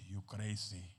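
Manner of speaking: startled aside. Retold or imitated. His own startled reply to God.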